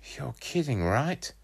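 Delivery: put-on high, disbelieving boy voice; fed up and incredulous; exasperated. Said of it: "I said, exasperated".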